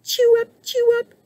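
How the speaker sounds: very high, peppy chirp; chirping cricket. A narrator's reading. Cricket popping up to join the band, high chirp. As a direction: chirp it in a tiny squeaky voice. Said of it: "chirped Cricket".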